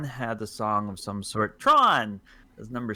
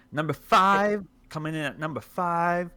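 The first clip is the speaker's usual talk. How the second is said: countdown announcer; 6 semitones higher, 3 dB louder than usual. Pretend.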